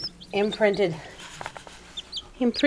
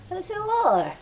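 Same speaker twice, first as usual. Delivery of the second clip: high doting coo; 8 semitones higher than usual. Real, not acted. Keeper reassuring the jealous dog, high baby talk.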